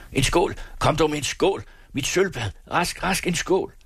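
high, urgent cries for help; frantic high-pitched demand; panicked urging. A narrator's reading. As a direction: call out in mounting alarm. Panicked call for a bowl.

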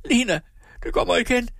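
very high, strained wail without full volume; terrified crying out. A narrator's reading. Husband calling Lina as darkness closes in, terrified. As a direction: cry for help as terror takes over. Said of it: "gav sig til at skrige".

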